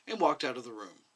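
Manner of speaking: ordinary delivery, nothing marked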